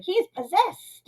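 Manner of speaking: high and slow; high dramatic gasp. Retold or imitated. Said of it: "and Fantasy like".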